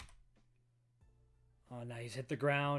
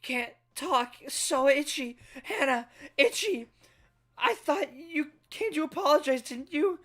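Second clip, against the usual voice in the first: put-on high, loud child voice begging, rising to a cry; a scared itchy kid clinging to Hannah; desperate child pleading; 12 semitones higher, 10 dB louder than usual. Child begs Hannah to protect him, high and loud.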